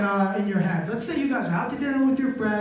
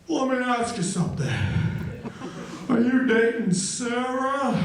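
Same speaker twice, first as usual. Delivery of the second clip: mock breathless teacher voice; out-of-breath gym teacher. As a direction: puff between the words like a winded coach. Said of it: "sounded like he was out of breath".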